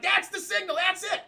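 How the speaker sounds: high-pitched, loud yell of a cue; excited shout. Acted. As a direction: shout it like the go signal on set. Acting out the crew man's excited cue.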